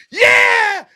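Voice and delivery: loud high cheer of "Yeah!"; hyped yell. Retold or imitated. Egged-on drunk friend cheering before shots, loud hyped yell. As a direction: roar it like you're psyched to slam shots. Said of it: "And he'd be like".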